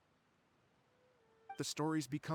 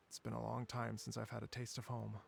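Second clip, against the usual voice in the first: wistful; 6 semitones lower, 4 dB quieter than usual. Acted. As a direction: lower and softer, longing for home. Misses home after sighing.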